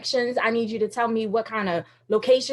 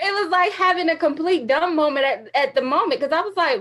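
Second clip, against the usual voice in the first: ordinary delivery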